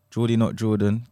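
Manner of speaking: normal talking voice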